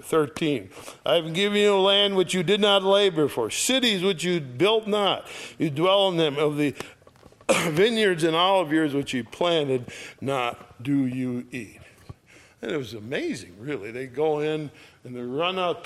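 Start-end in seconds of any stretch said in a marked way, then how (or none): 1.0-11.8 higher, louder, fast Bible reading; scripture reading. Acted. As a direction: proclaim the verses to the congregation. Reads scripture aloud.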